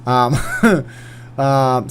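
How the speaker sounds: ordinary talk, nothing marked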